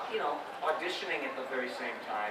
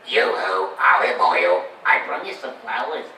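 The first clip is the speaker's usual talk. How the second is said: lower, loud combined Popeye voice; 6 semitones lower, 13 dB louder, slower than usual. Shown as a demonstration. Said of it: "you stuck 'em together".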